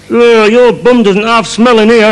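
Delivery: high, slow mock kid voice; little child's voice. Child inside the donkey costume complaining, little high voice. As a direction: pipe up as a small child. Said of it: "comes a little voice".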